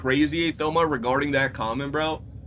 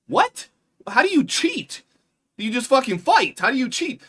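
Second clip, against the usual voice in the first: higher, louder incredulous what; disbelief; 8 semitones higher, 5 dB louder than usual. Retold or imitated. Streamer retelling his reaction to the cheating news, higher and louder in disbelief. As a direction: play up the disbelief.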